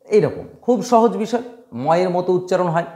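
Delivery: ordinary talk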